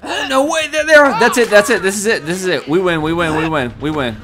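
high, rapid-fire elation easing down; real excitement. Real, not acted. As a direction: blurt it out as victory suddenly appears. Suddenly sees the winning targets.

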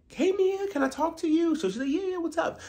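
very high sugary hellos; mock sweet greeting. Mocking Gizelle greeting Mia, very high. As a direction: coo it like fake friends.

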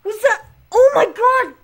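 high, loud panicked exclamations; freaking out. Real, not acted. Gamer spotting signs of an alien, freaking out. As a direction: blurt it out in alarm. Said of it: "I'm freaking out now".

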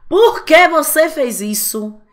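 hammed-up furious parent demanding answers; big theatrical scolding; mock angry parent. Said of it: "encarna a Fat Family".

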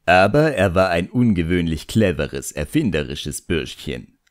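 usual speaking voice

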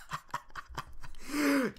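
high gleeful cackle; gleeful laugh. Real, not acted. Player gloating over his new sword, gleeful laugh. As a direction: laugh like a giddy villain.

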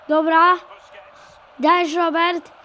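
ordinary talk

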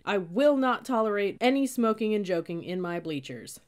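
bellowing. A narrator's reading. Cadet scolding recruits on his bleachers, bellowing. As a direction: fill the gym with your order. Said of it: "His voice reverberated through the gym".

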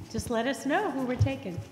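normal talking voice

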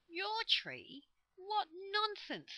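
high, loud, incredulous outburst; indignant disbelief. A narrator's reading. Joe disputes the goblins' claim to the tree, incredulous and indignant. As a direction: scoff at it in high-pitched disbelief.

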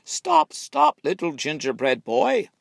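loud high cry to stop him; shouting. A narrator's reading. Old woman and man chase him, shouting loudly. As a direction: yell after the runaway.

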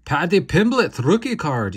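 put-on excited, loud, high stab at an English accent; hammed-up English accent, pitched high and loud; bad English accent. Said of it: "Excuse my terrible English accent".

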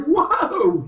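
high, stunned whoa of discovery; amazed whoa. Retold or imitated. Retelling a friend's reaction, a high amazed whoa. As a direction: gasp it out like a sudden revelation.

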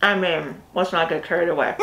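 chewing while talking behind hand; muffled through covering hand; mouth full, hand over mouth. Real, not acted. Seen 0: Woman chews with hand over mouth; speaker uncertain, man also eating.

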